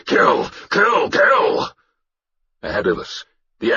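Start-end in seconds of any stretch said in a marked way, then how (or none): 0.1-1.7 frenzied high battle cries; repeated high-pitched war shouts; shouted. Acted. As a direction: yell it over and over as a war chant. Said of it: "I shouted".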